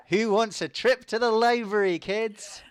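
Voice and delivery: put-on high, bright call to the kids; a grown-up rallying children for an outing; hammed-up teacher voice; cheery chaperone.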